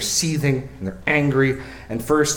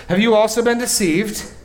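high, loud scornful voice of the Pharisees; sneering rebuke; 10 semitones higher, 5 dB louder than usual. Acted. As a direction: spit the question down at them. Pharisees rebuking officers again.

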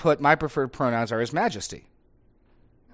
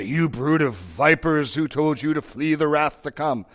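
relished rebuke; 2 semitones higher than usual. Acted. Preacher acting out Jesus rebuking the vipers, relished rebuke. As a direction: relish the rebuke, stretching the opening words.

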